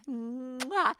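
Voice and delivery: big, high smacked air kiss; blown kiss. Retold or imitated. Friend blowing a kiss to herself, exaggerated smack. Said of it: "she blows a kiss in the mirror".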